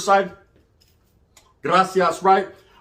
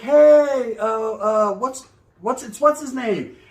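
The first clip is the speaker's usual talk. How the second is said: mock flustered girl; 3 semitones higher, much slower than usual. Girl fumbling to introduce him.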